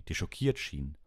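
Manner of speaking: ordinary delivery, nothing marked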